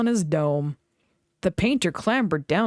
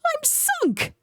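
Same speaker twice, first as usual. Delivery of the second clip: dismayed engine. A narrator's reading. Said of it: "said Thomas".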